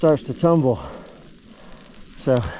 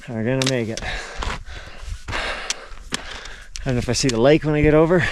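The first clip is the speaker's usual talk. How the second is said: normal talking voice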